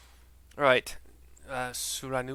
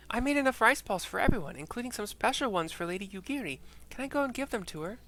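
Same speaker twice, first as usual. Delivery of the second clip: put-on much higher, louder child's voice; little girl; 10 semitones higher, 5 dB louder than usual. Little girl asking to bring rice balls, high child voice. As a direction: chirp it like an eager little girl. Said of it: "she's like".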